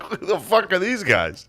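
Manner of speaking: high laughing incredulous quote; laughing disbelief. Retold or imitated. Retelling fans turning on him, laughing disbelief. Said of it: "And I was like".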